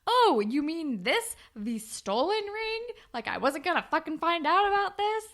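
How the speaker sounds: high, sarcastic imitated outrage; mock angry confrontation. Voices Manny confronting Jay over ring.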